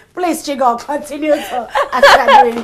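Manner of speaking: high, laughing mock plea to the brand. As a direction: ham up a grateful plea while laughing. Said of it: "Let me never, never, ever say".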